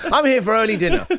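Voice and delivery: high, giggling diner announcement; mock diner laughing; laughing mock greeting. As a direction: say it while cracking up at yourself.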